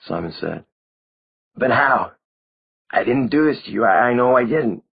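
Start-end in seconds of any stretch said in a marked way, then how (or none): 1.5-4.8 shocked. A narrator's reading. Simon shocked by vampire friend.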